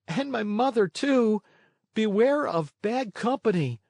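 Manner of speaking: high, pleading puppet voice, lightly tearful; bitter sobbing. A narrator's reading. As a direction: Pinocchio begging his fallen schoolmate to wake. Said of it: "Sobbing bitterly".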